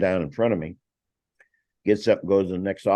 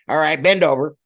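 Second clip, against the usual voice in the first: loud, higher barked command; stern order; 9 semitones higher, 7 dB louder than usual. Retold or imitated. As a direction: order him to bend over. Officer orders strip search.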